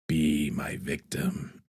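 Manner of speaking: low, very slow, soft villain voice; Candyman. Acted. Streamer imitates the villain walking up to you, hushed eerie voice. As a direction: ethereal but scary, stretched out quietly. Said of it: "in that voice".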